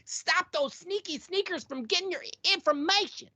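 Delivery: high, hammed-up ad voice; hammed-up warning. Host warning listeners about data snoops, hammed-up alarm. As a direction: sound the alarm, high and comical. Comic line closing ad read.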